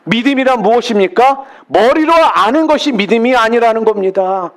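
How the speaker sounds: ordinary talk, nothing marked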